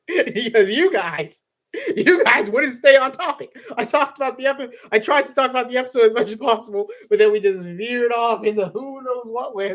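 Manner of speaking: high, loud, laughing rant. Real, not acted. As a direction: laugh through the complaint. Laughing about after shows.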